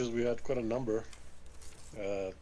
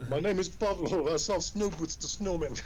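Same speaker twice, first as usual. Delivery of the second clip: higher, slow mock Russian accent; Jack Peralta's Russian accent; 5 semitones higher, slower than usual. Player demonstrating for the game master, slow Russian accent. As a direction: imitate a sitcom cop's bad Russian accent. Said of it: "when he does the Russian accent impression".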